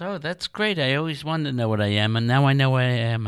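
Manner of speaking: high-pitched, fast, gushing delight; delighted rabbit. Acted. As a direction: act out a thrilled rabbit in a rush. Rabbit delighted to learn what it is.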